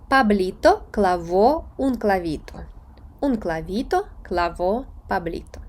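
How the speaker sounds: slow, syllable-by-syllable Spanish recitation; slow tongue twister. Shown as a demonstration. Teacher reading a tongue twister for learners, slow. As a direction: take the tongue twister slowly, phrase by phrase. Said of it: "я їх буду читати повільно".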